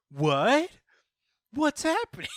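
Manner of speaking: loud, high clueless yelp; hammed-up confusion, half laughing; mock bewilderment. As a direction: act totally lost, voice shooting up. Acting Krillin's clueless reaction.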